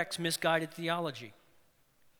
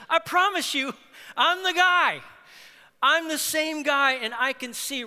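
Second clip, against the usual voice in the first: much higher and louder, pleading to be believed; excited insistence; 8 semitones higher, 7 dB louder than usual. Acted. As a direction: convince them you're the same guy. Healed man insists to neighbors.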